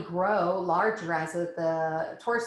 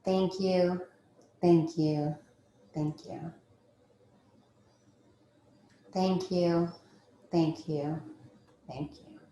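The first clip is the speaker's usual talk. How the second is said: fading thank you; slower than usual. Acted. Gratitude rounds, fading to breath.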